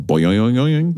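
bouncy spoken boing; cartoon spring noise; boing sound effect. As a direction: go up and down on each "boing". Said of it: "That's the sound effect".

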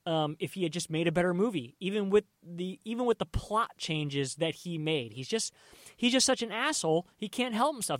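normal talking voice

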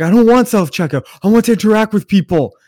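loud, high-pitched imitation of outrage; angry rant. Friend refusing self-checkout, imitated angry rant. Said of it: "he's angry as shit".

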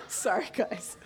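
quiet, helpless laugh into an apology; breaking into laughter. Real, not acted. Pastor apologising to congregation, laughing. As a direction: lose it, then apologise through laughter. Cracks up at own joke.

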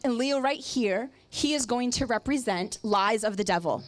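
usual speaking voice

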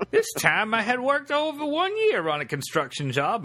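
high put-on prospector voice. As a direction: keep the old prospector going. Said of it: "like a prospector voice?".

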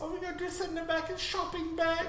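high squeaky fake German accent; mock German accent. Friends dub the movie scene, mock German accent. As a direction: squeak it out like a nervous German henchman.

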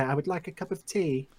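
lightly put-on English accent, a posh request for tea; a hint of a British accent on I would like a cup of tea. Shown as a demonstration. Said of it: "You have to do an English accent now".

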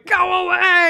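high, very loud acted warning; hammed-up shout to keep someone away; desperate mock yell. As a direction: yell it out as the man turning werewolf. Retold man warning as he changes.

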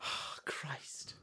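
whispered Oh, Christ under the breath; hushed exasperated whisper; whispered groan. Real, not acted. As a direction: whisper it in mock despair. Co-host finds a BuzzFeed quiz.

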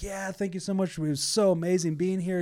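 high, bubbly thanks; high, eager elderly gush; gushing gratitude. Retold or imitated. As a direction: beam with delight as you thank him. Grateful couple thanks first mate.

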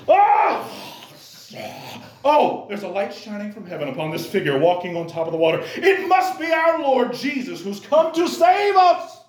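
melodramatic disciple. Pretend. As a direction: overplay the holy vision like bad theatre. Mocks a dramatic misreading.

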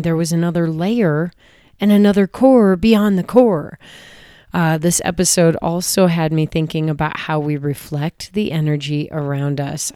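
ordinary talk, nothing marked

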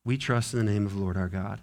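quiet fast restatement. Real, not acted. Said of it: "repeated creed quietly".

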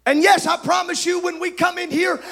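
rising, shouted preacher's cadence; full-force preaching. Acted. Preacher to his congregation, full-force preaching. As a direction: drive it home to the pews.

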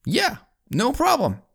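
very high, easygoing call back; worker on the ground. Acted. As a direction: answer up to the tower in a high voice. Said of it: "the guy on the ground says".

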